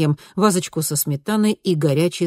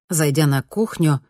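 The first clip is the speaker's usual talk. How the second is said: ordinary delivery, nothing marked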